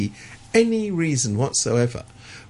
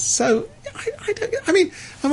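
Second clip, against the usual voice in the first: laughing. Real, not acted. Laughs at own irony.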